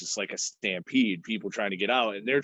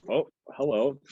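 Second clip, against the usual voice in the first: cooing greeting; 5 semitones higher, 3 dB louder than usual. Real, not acted. Greeting a cat that appears.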